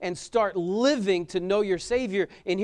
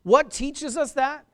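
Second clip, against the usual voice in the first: loud, high rhetorical question; preaching call; 8 semitones higher, 6 dB louder, slower than usual. Acted. Preacher challenging the congregation, loud and high.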